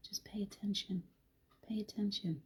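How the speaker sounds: hushed whisper, soft and unhurried; whispered reminder. Acted. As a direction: whisper it like a secret nudge. Gentle reminder to slow down.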